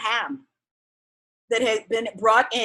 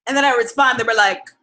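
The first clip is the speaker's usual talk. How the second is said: ordinary delivery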